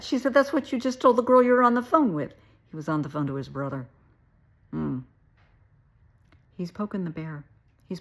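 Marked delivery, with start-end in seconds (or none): 0.3-2.3 fast, loud, high retold accusation; accusing retort. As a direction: imitate her throwing it back at him. Acted accusation in quarrel.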